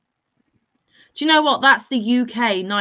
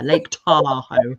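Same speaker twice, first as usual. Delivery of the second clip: slow, lower posh "Lake Tahoe"; 4 semitones lower, 5 dB louder, much slower than usual. Shown as a demonstration. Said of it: "I get my posh voice on".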